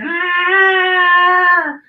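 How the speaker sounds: put-on high, loud squeal of a frightened little girl; mock girl's scream. Little girl on cover thinking.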